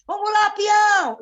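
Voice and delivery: loud, high drawn-out cowboy call; stretched-out shouted rallying cry; rodeo shout. Retold or imitated. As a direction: holler like urging on a horse. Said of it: "Batendo como se fosse".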